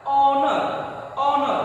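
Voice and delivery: ऑनर said with a silent h, opening on an o sound; pronunciation demo. Shown as a demonstration. Teacher models ऑनर's o sound for students. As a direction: say ऑनर as "onar", no h at the start. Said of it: "इसका pronunciation देखिए क्या है".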